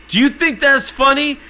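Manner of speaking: high, loud mimicked challenge; retold indignant confrontation; outraged guard. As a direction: imitate a guard snapping at a joker. Furious guard confronting teller.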